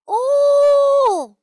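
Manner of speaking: long, high, loud hoot, dropping at the end; drawn-out wailing "ooh". Acted. Strange noise echoing from the wardrobe, a loud high wail.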